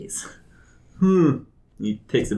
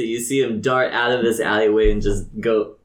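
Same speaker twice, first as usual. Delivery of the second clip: ordinary talk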